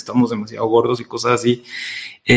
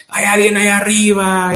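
high, stretched-out call for help; retold shout; 9 semitones higher than usual. Fallen climber calling for help from a branch, mock shout. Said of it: "empieza a gritar".

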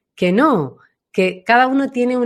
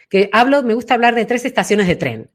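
normal talking voice

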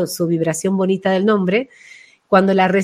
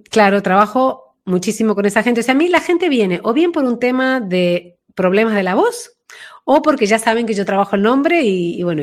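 usual speaking voice

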